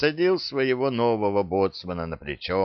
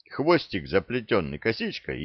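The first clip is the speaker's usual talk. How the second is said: plain reading voice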